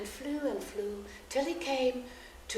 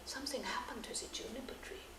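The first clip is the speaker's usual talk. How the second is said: hushed whisper; 12 dB quieter, faster than usual. A narrator's reading. Mystery moment in fairy tale.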